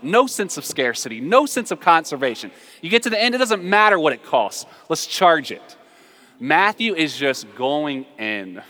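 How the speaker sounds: usual speaking voice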